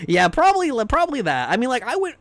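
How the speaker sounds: sheepish loud laughing admission; excited. Real, not acted. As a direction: blurt it out embarrassed.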